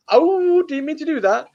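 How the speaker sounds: retold apology after spilling the pop; apologetic oh. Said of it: "I was like".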